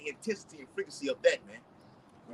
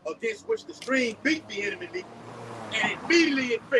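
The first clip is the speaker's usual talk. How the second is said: scripture reading; 6 semitones higher than usual. Acted. Reads scripture aloud.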